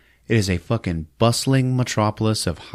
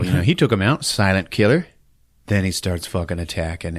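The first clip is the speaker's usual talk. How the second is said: normal talking voice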